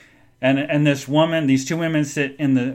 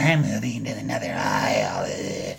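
absurdly low monster-like mock voice; hammed-up deep grumbling impression; fed-up passenger; 5 dB quieter than usual. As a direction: imitate her in a very deep, exaggerated voice. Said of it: "she gets up and she's like".

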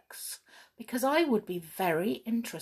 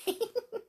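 put-on girlish giggle; 7 dB quieter than usual. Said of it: "beginning to giggle".

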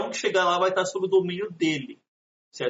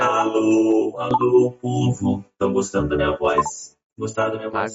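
deep pitched-down voice changer; 7 semitones lower, 4 dB louder, slower than usual. Pretend. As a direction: talk through a low voice filter. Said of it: "Eu vou falar com outra voz".

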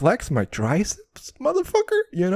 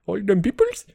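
mock Indian accent, "peoples" rising as a question; joking Indian-accent take on "All them peoples?"; 6 semitones higher than usual. Shown as a demonstration. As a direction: put a hammed-up Indian accent on "All them peoples?", pitch climbing to the end.

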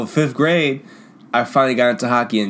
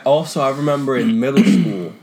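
usual speaking voice

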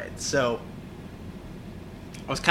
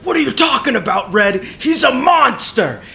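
high loud panicked shout; mock frantic shout; 11 semitones higher, 10 dB louder than usual. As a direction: yell the warning at Red. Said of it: "the Bomb Bird's being like".

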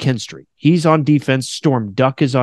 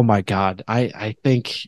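ordinary delivery

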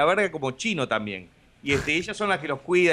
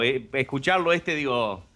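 ordinary delivery

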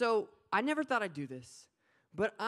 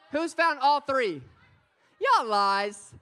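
shouting up to the crowd; loud, high call to the room; 4 semitones higher, 11 dB louder, slower than usual. Real, not acted. Preacher calling out to crowd.

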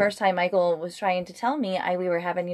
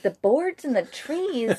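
slow, higher questioning repeat; puzzled echo; 6 semitones higher, much slower than usual. Retold or imitated. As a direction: echo it slowly in disbelief. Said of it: "And I was like".

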